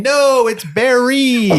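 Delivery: high, slow mock yell of Barry. Corrects the stewardess across the plane with a high, slow mock yell. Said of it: "who yells out in the airplane".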